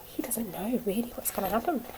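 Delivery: secretive whisper. Real, not acted. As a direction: let them in on a secret. Secret aside to children.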